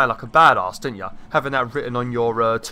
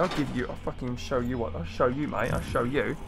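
muttered threat; 8 dB quieter than usual. Real, not acted. Threatening a game enemy while attacking.

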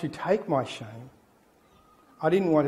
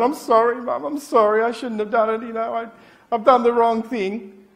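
high, pleading, hurried sorry; panicked apology; 6 semitones higher, 4 dB louder than usual. Retold or imitated. Child freaking out, apologising to mum in panic. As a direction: beg mum to forgive you. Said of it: "now I'm freaking out".

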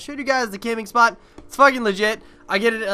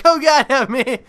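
hammed-up pleading, louder and higher; mock crying; 5 semitones higher, 6 dB louder, slower than usual. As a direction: play-act crying out for mercy. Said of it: "crying like".

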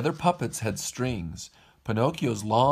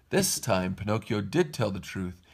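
plain reading voice

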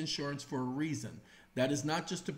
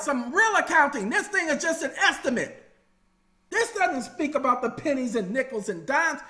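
loud, high, heated demand for real accounting; indignant demand; 9 semitones higher, 7 dB louder than usual. Acted. Homeowner shouting at the lender for a certified accounting. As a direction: play the homeowner fed up with the bank. Debtor demanding proof of debt.

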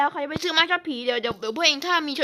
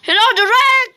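calling out loudly; 8 semitones higher, 7 dB louder than usual. Real, not acted. Calls out to the ghost child.

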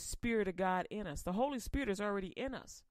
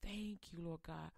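softer, hushed prayer of thanks; closing prayer; 3 semitones lower, 6 dB quieter than usual. Acted. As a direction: pray quietly, as if alone with God. Pastor leads a closing prayer.

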